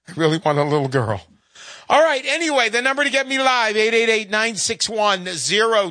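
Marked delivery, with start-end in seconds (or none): 0.1-1.2 emotional. Real, not acted. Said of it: "Sorry to get a little emotional".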